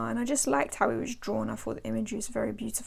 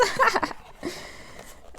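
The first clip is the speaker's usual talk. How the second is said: high-pitched burst of laughter; self-amused giggle, higher; 9 semitones higher than usual. Real, not acted. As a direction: laugh at your own pretentiousness. Laughs at own pretentious French.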